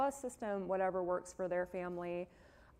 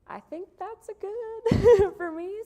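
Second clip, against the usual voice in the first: laughing; 15 dB louder than usual. Real, not acted. Laughing while wrapping up.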